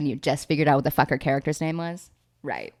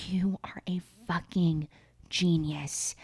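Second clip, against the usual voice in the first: hushed, breath-only admiration; awed mock whisper; 7 dB quieter than usual. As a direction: whisper it as if awestruck. Retold Miranda awed by his plan.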